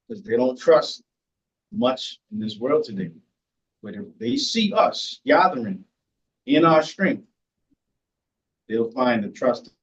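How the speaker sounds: ordinary talk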